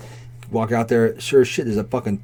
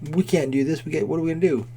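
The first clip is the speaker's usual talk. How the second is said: imitated panicky waitress, higher pitched; mock freak-out in a raised pitch; 6 semitones higher than usual. Waitress overwhelmed by the crowd, freaking out. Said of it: "like, freaking out".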